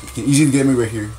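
fast, loud battle calls; shouting orders over battle. Real, not acted. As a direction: bark it out mid-fight. Tense firefight, urging himself.

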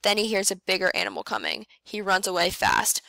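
ordinary delivery, nothing marked

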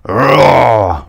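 loud, drawn-out roar; bear's roar. A narrator's reading. The bear facing Little Miss Bossy, loud roar. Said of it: "roared the bear".

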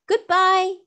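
loud, very high farewell shout; shouted goodbye. Acted. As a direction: shout goodbye to the mermaids across the cave. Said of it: "shouted both Trot and Cap'n Bill".

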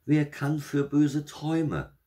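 put-on very high, slow old-lady excuse; placating grandmother. A narrator's reading. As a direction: soothe him in a high, drawn-out voice. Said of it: "sie besänftigte ihn nochmals".

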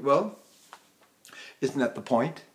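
ordinary talk, nothing marked

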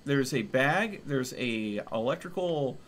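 ordinary talk, nothing marked

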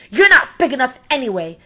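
loud, high, dismissive sneer; scornful brush-off. Acted. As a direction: wave him off to save face. Grouchy ladybug sulks.

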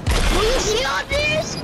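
high, slow and loud wonder; amazed. Real, not acted. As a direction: marvel at the sight. Amazed at loot.

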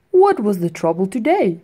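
put-on firefly greeting the boy at his window; friendly firefly. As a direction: greet him like a gentle old friend. Firefly asks.

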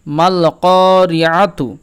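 "মাল করি আতু" very slow, full l and long vowel on "ক"; slow model recitation of "মাল করি আতু"; lam fully pronounced. Shown as a demonstration. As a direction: draw out "করি" after a clear "মাল". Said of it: "লামের উচ্চারণ পূর্ণভাবে হবে".

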